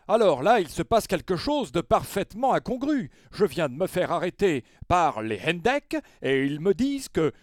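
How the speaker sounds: high, loud, overly formal phone voice; pompous caller voice. Retold or imitated. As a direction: play it grand, like calling the mayor. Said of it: "nous faire sa meilleure interprétation".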